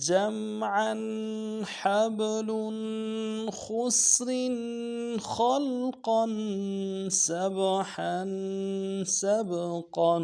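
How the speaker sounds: ایک سانس میں رواں تلاوت; recite in one breath. Shown as a demonstration. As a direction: chant the words straight through on one breath. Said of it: "بغیر سانس توڑے ہوئے".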